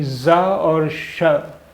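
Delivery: जा और शा drawn out, each syllable held; slow sound blend. Shown as a demonstration. Teacher shows the mixed zh sound, slowly. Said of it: "यानी".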